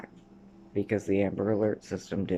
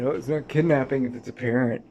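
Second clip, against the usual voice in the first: a higher, louder mocking voice for the man; mocking Mr Peterson; 5 semitones higher, 6 dB louder, faster than usual. Mocking the man who defends the kidnapping, high and loud. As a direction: sneer out his excuse, louder. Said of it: "why he's trying to always defend it".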